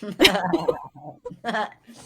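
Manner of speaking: big laugh out loud. Real, not acted. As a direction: burst of laughter. Laughs at Ramen jab.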